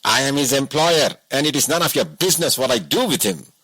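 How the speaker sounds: mildly angry boss, fast; a touch of rude irritation; angrily. A narrator's reading. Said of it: "he said angrily".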